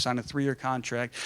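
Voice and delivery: normal talking voice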